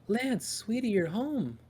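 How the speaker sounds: gasp, then a high, sweet welcome; delighted loved one. Acted. Woman looks up from her book at Lance coming home, delighted.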